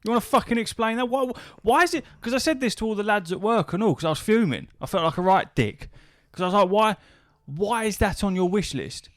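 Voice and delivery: real high-pitched indignant rant; exasperated rant. Real, not acted. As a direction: demand an answer, fuming. 'cause I was fuming.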